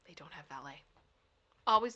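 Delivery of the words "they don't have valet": breathy hushed aside, no voice; whisper. Real, not acted. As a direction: drop to a secret whisper.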